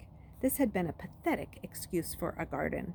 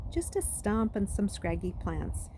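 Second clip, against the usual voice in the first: ordinary delivery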